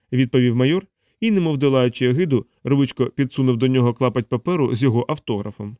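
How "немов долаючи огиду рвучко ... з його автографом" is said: usual speaking voice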